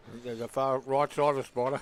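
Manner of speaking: talking with mouth full; words round a mouthful of food. Real, not acted. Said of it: "Don't talk with your mouth full".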